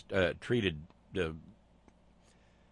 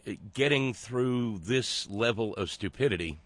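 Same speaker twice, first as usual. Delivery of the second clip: slow, halting delivery with long pauses between phrases; deliberately slow. Real, not acted. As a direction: say it slowly, like trudging through thick mud. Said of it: "I'm gonna say this sort of slowly".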